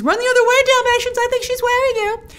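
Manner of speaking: high-pitched playful alarm, held up throughout; mock warning. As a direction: call out a pretend warning in a high voice.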